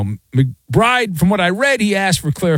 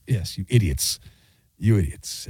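low, soft scornful you idiots; 8 semitones lower, 7 dB quieter than usual. Real, not acted. Host scolding the outraged public, quiet scorn. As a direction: put them down quietly and with contempt. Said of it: "answering the mock shriek".

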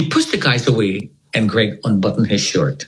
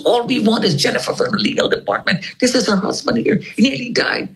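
high-pitched, pushy demand to the guards; retold frantic plea for his wife; noisy urgent pleading; 11 semitones higher than usual. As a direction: press the guards hard, voice up high. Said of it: "Albert is making so much noise".

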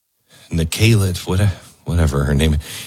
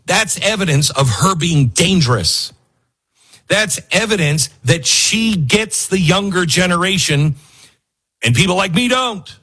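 ordinary delivery

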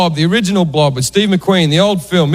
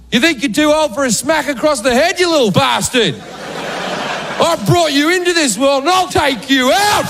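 mock furious mother, pitched up; angry mum; 5 semitones higher than usual. Mum threatens her cheeky son from her chair, angry. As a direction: scold and threaten like a fed-up mum. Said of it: "she's so fat and so angry".

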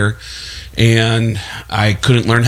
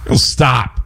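Christopher Walken impression; 3 semitones higher than usual. Shown as a demonstration. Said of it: "stop and go kind of voice".

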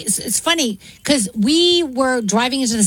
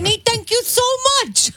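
gushing imitated father's thanks; higher, effusive dad voice; delighted dad; 10 semitones higher than usual. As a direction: thank them as if thrilled by a tiny thing. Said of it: "my dad thought everything was fantastic".

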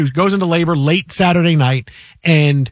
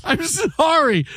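laughing; 10 semitones higher, 3 dB quieter, much slower than usual. Real, not acted. Laughing at own story.

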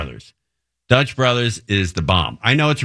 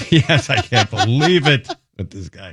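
laughing daughter quote. Retold or imitated. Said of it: "She's like".

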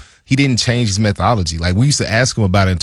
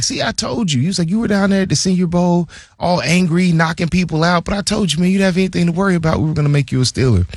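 much higher, lightly comic voice for the GM; teasing GM voice, high and fast at the end; imitating Kevin Colbert; 10 semitones higher than usual. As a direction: playfully mimic the GM saying I told you so, pitched way up.

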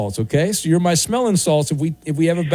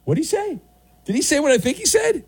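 surprised; 10 semitones higher than usual. Real, not acted. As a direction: pitch jumps up in disbelief. Host surprised by caller.

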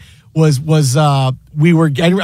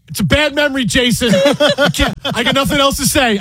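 higher, mock-speechless concession; Michael Rappaport; 8 semitones higher than usual. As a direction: imitate a stumped co-host giving up. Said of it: "'cause he couldn't talk".

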